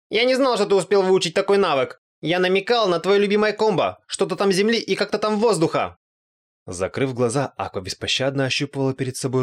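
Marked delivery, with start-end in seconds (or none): 0.1-6.0 put-on high, loud, indignant girl's voice; whining Aqua. A narrator's reading. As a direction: protest in a high wail. Аква оправдывается, паникуя. (высокий возмущённый голос Аквы)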